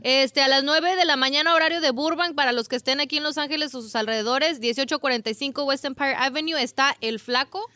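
parish announcement. Pretend. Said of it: "¿puedo hacer anuncio parroquial?".

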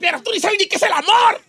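very high, wailing mock scolding; reproche dramático. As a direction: scold shrieking, soap-opera style. Tribe scolds the girl. (reproche chillón y exagerado)

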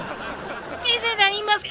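drawn-out mock parroting; mocking echo. Teacher mimics the deep-voiced pupil.

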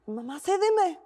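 hammed-up teen begging; mock dramatic plea. Teen self begging mom for Los Ángeles, mock plea. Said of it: "Bueno, no así".

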